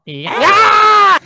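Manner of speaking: high, loud joyful shout; excited yell. Real, not acted. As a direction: burst out with a whoop. Excited exclamation.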